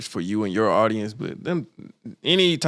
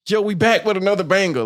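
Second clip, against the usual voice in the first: lightly mocked YouTuber opener, pitched up; YouTuber intro; 7 semitones higher, 3 dB louder than usual. Mocking how every YouTuber starts off, hyped intro voice. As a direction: imitate a hyped-up vlog intro. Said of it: "every YouTuber starts off with".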